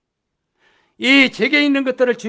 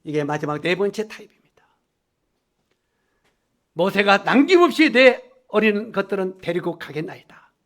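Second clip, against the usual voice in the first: ordinary talk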